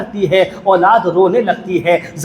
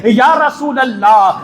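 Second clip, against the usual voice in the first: calling out; 3 semitones higher than usual. Acted. Husain petitions the Prophet.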